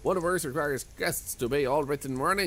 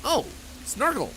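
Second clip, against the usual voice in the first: delighted surprise; 14 semitones higher than usual. Real, not acted. Finds a snorkel.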